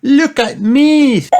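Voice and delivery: very high, loud, drawn-out call; show-off child. A narrator's reading. Peppa shows off her costume, high loud child voice. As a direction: call out proudly in a little-girl voice.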